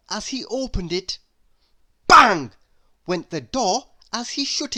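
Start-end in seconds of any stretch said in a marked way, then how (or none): 2.1-2.5 shouted sound word; bang. Acted. Reader voicing the door slam, a loud shouted bang. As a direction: belt out the slam.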